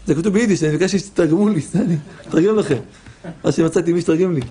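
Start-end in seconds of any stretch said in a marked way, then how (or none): none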